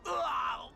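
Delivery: loud grossed-out groan; disgusted groan. Acted. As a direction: spit out a disgusted ugh. Sand in her mouth.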